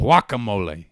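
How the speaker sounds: loud mock Spanish echo; punched tourist-Spanish food word; dad's Spanish accent. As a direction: parrot it in an overdone Spanish accent. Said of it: "He says guacamole".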